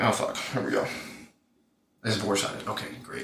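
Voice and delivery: dropping to a quiet sarcastic mutter; resigned sarcastic aside, trailing off quiet. Retold or imitated. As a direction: mutter it like you already know it's doomed. Retold exasperation at bore sighted.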